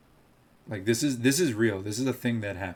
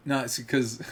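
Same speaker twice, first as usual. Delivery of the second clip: ordinary delivery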